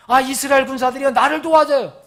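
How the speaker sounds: high, loud mock cry to the troops; shouted call for help. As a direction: call out to soldiers for rescue. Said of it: "이렇게 소리 질렀겠어요?". (군사들에게 크게 외치는 도움 요청)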